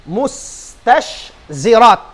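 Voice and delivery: mustashzirat spelled out slowly, syllable by syllable; slow careful pronunciation. Shown as a demonstration. Teacher drilling the class on mustashzirat, slow and careful. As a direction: draw out mustashzirat so students hear each sound. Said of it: "pronounce niyo kuno marayaw".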